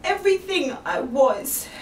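ordinary talk, nothing marked

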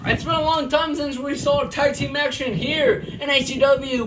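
loud, pumped-up wrestling commentary with yells at big moves; live play-by-play. Acted. Commentator calling a backyard wrestling match, hyped play-by-play. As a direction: call the match live for the crowd.

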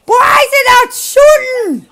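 loud, slow, exasperated yell at the gun; frustrated shout. Real, not acted. Yelling at a gun that won't fire, frustrated shout. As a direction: yell it at the broken gun, slowly.

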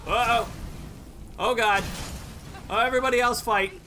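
scrambling in a sudden panic; panicked alarm. Real, not acted. Outnumbered in sudden fight.

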